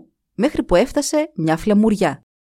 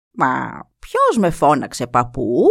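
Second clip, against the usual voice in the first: slow, lower, wondering question; puzzled; 5 semitones lower, slower than usual. Acted. The wind eagle wonders who called him, puzzled. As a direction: sound baffled at who is calling. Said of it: "είπε με απορία".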